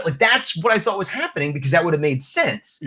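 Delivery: ordinary talk, nothing marked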